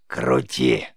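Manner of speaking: loud, low creaky order; commanding witch rasp. A narrator's reading. Witch orders the boy to heal the rabbit, loud low rasp.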